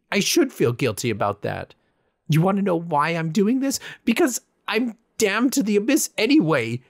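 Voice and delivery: loud, high bitter rant; raging. A narrator's reading. Confessing broken promises to absent reapers, raging. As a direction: lash out, breathless and bitter. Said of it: "I barely took a breath before I continued raging".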